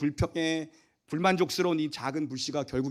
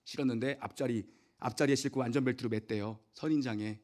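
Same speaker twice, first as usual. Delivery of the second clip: ordinary delivery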